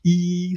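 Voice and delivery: "E" stretched wide and high; wide "E" vowel. Shown as a demonstration. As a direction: spread the lips wide for "E". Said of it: "E is more like a wide mouth".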